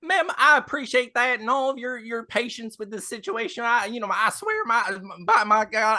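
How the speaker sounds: high, eager-to-please driver voice; folksy carriage driver, earnest. Acted. Stand-in driver promising the lady his best, high and earnest. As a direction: pledge your best in a rambling, high voice.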